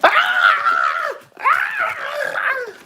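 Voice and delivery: shrill sustained "Ah!" screams, far above speaking pitch; high-pitched scream. Acted. Demonstrating protesters' outburst before rant.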